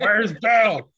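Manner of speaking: high, loud hillbilly imitation; hillbilly accent. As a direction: holler it like a mock hillbilly. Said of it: "a hillbilly accent".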